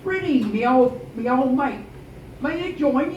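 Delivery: Underwood's greeting voice. Acted. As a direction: sweet-talk the old captain.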